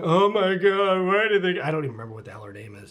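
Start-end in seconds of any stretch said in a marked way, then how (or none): 0.0-1.6 mocking a viewer sobbing over the little girl's death; mock tear-jerker. Mock weepy wail about a cartoonish tragedy. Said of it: "a big tear-jerking".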